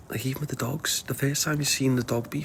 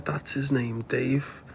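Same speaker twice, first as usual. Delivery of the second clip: normal talking voice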